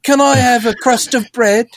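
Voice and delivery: high, pleading British orphan voice, slowed on the middle line; hammed-up pleading British urchin; British beggar. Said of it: "I don't know why they're British".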